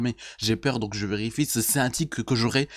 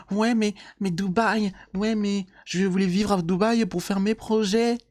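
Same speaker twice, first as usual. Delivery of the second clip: imitating Maëva; 7 semitones higher than usual. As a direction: whine like a spoiled influencer. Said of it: "t'as clairement dit".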